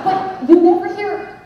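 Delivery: ordinary talk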